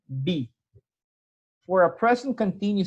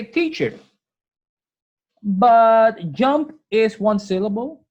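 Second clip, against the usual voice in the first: lightly voiced student, raised pitch and slow; mock student objection; 4 semitones higher, 5 dB louder, slower than usual. Teacher voicing a student who objects, a light high mock plea. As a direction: play the eager student pushing back on the teacher. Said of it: "So you would say".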